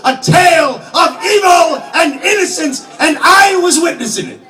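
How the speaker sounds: rising to a fever pitch over the names of America; impassioned shout. Acted. To the audience about his America, an impassioned shout. Climactic outburst in the monologue.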